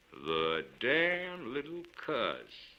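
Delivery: a rough oath said with hidden affection; gruff tenderness. A narrator's reading. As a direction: Kane Tuck pulling his finger free, gruffly tender. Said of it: "with perhaps more tenderness and care".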